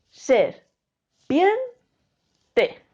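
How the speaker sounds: "ser, pien, te" with pauses, a rise on "pien"; split into syllables. Shown as a demonstration. Teacher splitting serpiente for the pupils, syllable by syllable. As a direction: give "ser", "pien", "te" separately, lifting "pien". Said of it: "Si separamos serpiente en sílabas".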